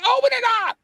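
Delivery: exasperated, high-pitched plea to open it; exasperated insistence. Retold or imitated. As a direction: demand it, voice leaping up.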